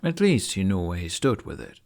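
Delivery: ordinary delivery, nothing marked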